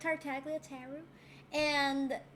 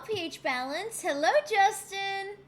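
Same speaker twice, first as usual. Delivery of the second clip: normal talking voice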